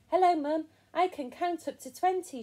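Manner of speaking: high, loud, eager greeting; bubbly, high-pitched announcement; excited child character. A narrator's reading. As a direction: show off proudly to mum. Proud child spider greets mum.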